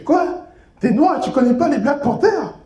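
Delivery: mock shock at a friend's ignorance. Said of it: "il est choqué".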